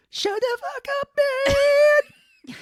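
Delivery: very high, loud mock scream; over-the-top shrieking rage; screaming Cage impression. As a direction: shriek it at her. Cage yelling at Diane.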